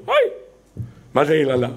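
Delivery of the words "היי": short high moan of pain; demonstrated pained yelp; sick person's groan. Shown as a demonstration. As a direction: cry out as if it hurts. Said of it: "כואב לו".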